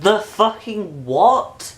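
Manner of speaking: slow, high, loud disbelief; incredulous outrage. Retold or imitated. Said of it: "and I'm like".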